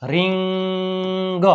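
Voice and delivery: "રિંગ" with a long-held nasal middle; drawn-out sounding out. Shown as a demonstration. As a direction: stretch "રિંગ" very slowly for learners. Teacher sounds out the word stretched.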